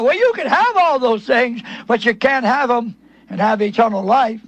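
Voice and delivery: ordinary talk, nothing marked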